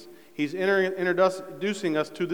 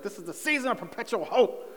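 higher-pitched, exasperated mock outburst; frustrated mom impression; 5 semitones higher, 3 dB quieter than usual. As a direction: imitate a mother at the end of her rope. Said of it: "she just gets all frustrated".

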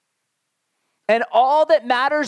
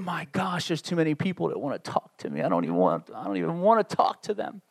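low, soft aside to oneself; private grumble; 6 semitones lower, 7 dB quieter than usual. Retold or imitated. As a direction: think it aloud under your breath, overwhelmed. Retold private thought, drops low.

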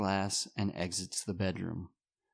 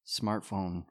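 normal talking voice

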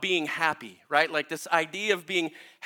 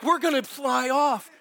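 retold panic; 6 semitones higher than usual. Panicked rider in retold camel ride.

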